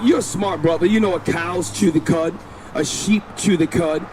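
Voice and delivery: usual speaking voice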